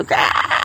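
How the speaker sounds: breathy unvoiced mock gremlin screech; gremlin shriek. Copying a Gremlins creature's shriek for the listeners. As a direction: spit out a little monster's shriek. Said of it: "and they go".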